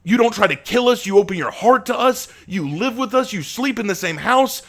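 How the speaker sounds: impassioned outburst. Acted. Asher telling Abraham why the club exists, impassioned. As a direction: pour it out passionately.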